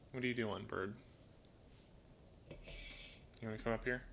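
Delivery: quiet, coaxing words to a bird; soft pet talk. Real, not acted. Owner softly coaxing the parrot onto a perch, quiet pet talk. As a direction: gently coax the bird to come over.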